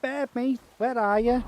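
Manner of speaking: hint of a Liverpool accent calling for the pig; Scouse impression. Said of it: "he's a Scouse at me".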